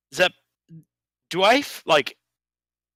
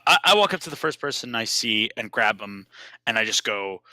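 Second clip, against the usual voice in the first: ordinary talk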